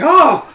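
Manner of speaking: loud, high "oh!"; startled exclamation. Acted. Game master voices the janitor dwarf reacting, startled exclamation. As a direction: burst out with a big acted "oh".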